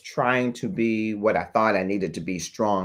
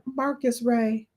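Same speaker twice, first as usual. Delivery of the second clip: lilting high sister voice calling him; sister's voice; 12 semitones higher than usual. Retold or imitated. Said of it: "I can hear her voice saying".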